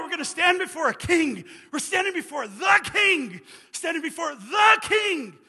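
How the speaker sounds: fiery preacher exhorting the congregation; preaching at full force. Acted. Preacher builds to a shout.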